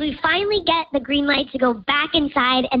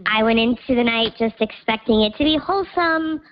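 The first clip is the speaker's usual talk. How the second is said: digitally disguised voice; pitch-shifted anonymous voice; voice changer; 4 semitones lower than usual. Real, not acted. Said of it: "'cause Voice Changer's on".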